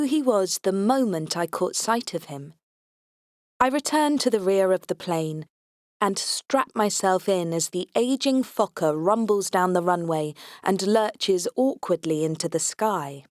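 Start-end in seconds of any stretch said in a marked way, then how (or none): none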